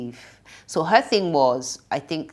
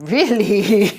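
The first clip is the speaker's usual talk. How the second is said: loud, high, incredulous cry; shocked disbelief; 6 semitones higher, 10 dB louder than usual. Retold or imitated. Reenacting her reaction to a friend's confession, shocked.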